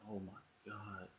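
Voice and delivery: quietly amazed; awed hush. Retold or imitated. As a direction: breathe it out slowly, stunned. Tasting the new dip.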